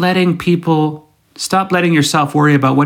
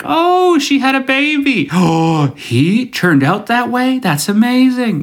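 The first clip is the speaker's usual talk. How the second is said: much higher, gasping gossip voice; mock surprise; 9 semitones higher, slower than usual. Stalking high school buddies from afar, gasping high mock surprise. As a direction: gasp and gush over old classmates' news.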